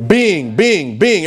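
deliberate, spaced-out repeats of BEING; slow reading. Shown as a demonstration. Preacher reading scripture for the congregation, slow reading. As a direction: draw out each BEING with a falling tune. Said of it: "I'm reading slow".

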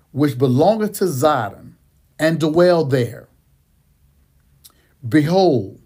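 slow, emphatic scripture reading; reading slowly. Shown as a demonstration. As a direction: take each word slowly and firmly. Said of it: "I'm reading this by, slowly on purpose".

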